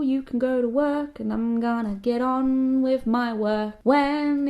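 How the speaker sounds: high, slow sing-song pep talk; drawn-out singsong, then a lazy refusal; mock cheery sing-song. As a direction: sing it like a too-sweet morning motivator. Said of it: "be supportive and be like".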